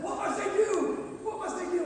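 high, urgent, pleading cry; desperate plea. Acted. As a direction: beg for an answer, voice pitched high. Desperate man kneeling before Jesus.

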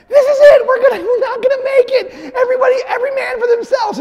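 hammed-up flight crew losing it, fast at the end; panicking stewards. Preacher acts out stewards announcing the plane is doomed, in mock shrieking panic. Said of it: "and they're like".

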